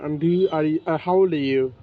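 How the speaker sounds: ordinary delivery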